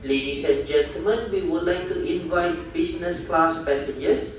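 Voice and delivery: boarding call over the PA speaker; formal gate announcement through loudspeaker; airport announcement. Real, not acted.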